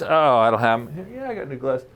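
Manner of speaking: ordinary delivery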